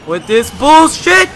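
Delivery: high, loud, drawn-out rage yell; raging gamer. Real, not acted. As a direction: explode at the game, slowly. Fed up with the game.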